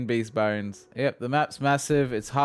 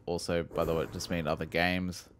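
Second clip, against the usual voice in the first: ordinary talk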